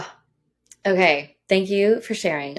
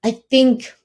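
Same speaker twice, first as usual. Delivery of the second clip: emotional; 5 semitones higher than usual. Real, not acted. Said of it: "I get a little emotional".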